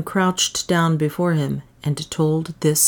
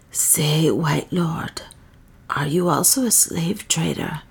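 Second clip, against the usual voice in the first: hushed question. A narrator's reading. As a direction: ask it in a secretive whisper. Old woman asks secretly.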